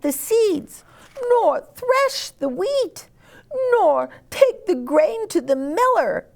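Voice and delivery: very high, slow indignant hen scolding. Acted. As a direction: tell off the lazy animals point by point.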